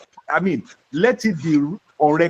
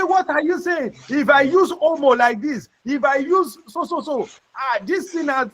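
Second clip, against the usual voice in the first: indignant politician; 7 semitones higher than usual. Retold or imitated. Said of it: "he said".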